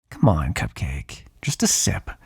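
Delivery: slow, low wheedle dropping into breath; drawn-out, teasing plea; coaxing. Acted. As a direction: coax them slowly for a little taste. Vampire wheedles hunter for a bite.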